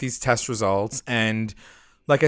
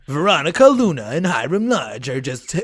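put-on promo voice teasing a showdown; mock-dramatic show intro; reality show announcer; 3 dB louder than usual. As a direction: announce the contestants like a TV trailer. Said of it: "reality show".

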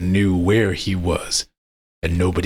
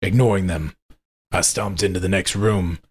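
ordinary delivery, nothing marked

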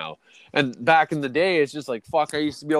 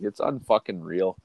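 ordinary talk, nothing marked

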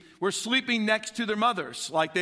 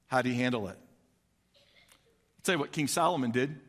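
ordinary talk

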